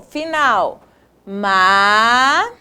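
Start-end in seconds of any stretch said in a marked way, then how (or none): 1.2-2.5 each syllable drawn out, long pauses between; syllable-by-syllable dictation. Shown as a demonstration. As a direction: dictate slowly, one held syllable at a time. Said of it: "separamos essas palavras em sílabas".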